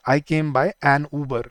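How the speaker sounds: "an" leaned on, whole line slow; slow example sentence. Shown as a demonstration. Teacher modelling the article choice. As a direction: hit "an" before "Uber". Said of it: "ऊ का example देता हूँ".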